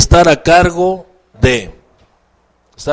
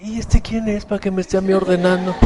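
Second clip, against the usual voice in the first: soft, higher grumble to oneself; resentful muttering; 4 semitones higher, 8 dB quieter, faster than usual. Acted. Martín privately resenting being given an order, muttering. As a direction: grumble under the breath.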